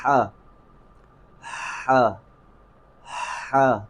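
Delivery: ہا said with a breathy throat sound like gas escaping; clear airy ha. Shown as a demonstration. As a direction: breathe out ہا from the throat, slow and drawn. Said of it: "وہ میرے ساتھ بار بار اسے پڑھیں". (ہا کو گلے سے ہوا نکالتے ہوئے ادا کریں)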